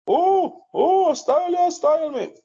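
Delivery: high, squeaky mock voice of a show-off; mocking imitation. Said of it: "It's always styling".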